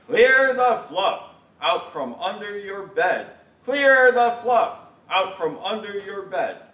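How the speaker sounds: put-on older brother lording it over the youngest; puffed-up brother. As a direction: gloat like a self-important big brother. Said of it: "all puffed up".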